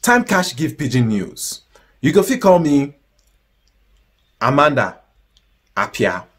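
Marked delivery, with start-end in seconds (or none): none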